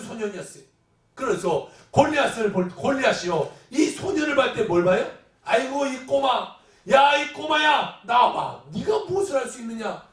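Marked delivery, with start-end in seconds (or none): 5.4-10.1 drawn-out, higher mocking call; belittling taunt. Goliath sneering at the small boy, belittling taunt. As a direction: jeer at a puny challenger, stretching the words.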